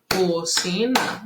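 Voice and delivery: slow, separated co-ci-na; clap-along beats on each syllable of cocina. Shown as a demonstration. Teacher showing how to find syllables, cocina in beats. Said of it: "batendo palminha".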